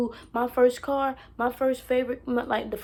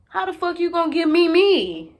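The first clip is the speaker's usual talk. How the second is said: loud, high, outraged; 6 semitones higher, 9 dB louder than usual. Real, not acted. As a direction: throw the question back in disbelief. Outraged rant at the caller.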